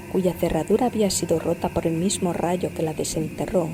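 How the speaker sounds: usual speaking voice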